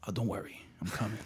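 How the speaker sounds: soft, quick aside to the waiting food; murmured to his food. Pretend. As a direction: reassure the food under his breath. Talking to his cold food.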